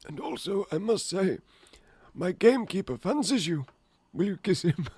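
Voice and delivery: high-pitched put-on voice; headmaster character. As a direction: play a coy headmaster in a high voice. Acts headmaster talking to Madame Maxine.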